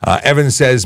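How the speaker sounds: ordinary delivery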